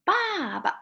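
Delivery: "Ba ba" high and falling, then a quick toneless "ba"; "Ba ba" with a short, light last syllable; neutral tone ending. Shown as a demonstration. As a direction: drop the first "Ba" firmly, clip the second. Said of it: "quick and".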